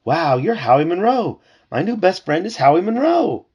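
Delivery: high, loud, thrilled fan; excited puppy. A narrator's reading. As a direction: gush at meeting a new best friend. Excited puppy meets his new friend.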